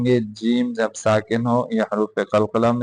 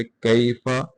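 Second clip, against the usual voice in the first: usual speaking voice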